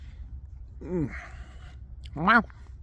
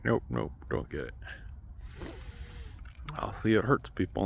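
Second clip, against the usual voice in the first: ordinary talk, nothing marked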